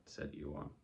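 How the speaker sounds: ordinary delivery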